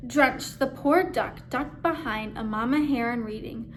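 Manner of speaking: plain reading voice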